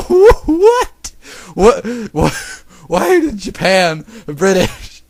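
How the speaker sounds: high, loud laughing disbelief; incredulous outburst. Real, not acted. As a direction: squawk it in baffled disbelief. Reader reacts to English accent line.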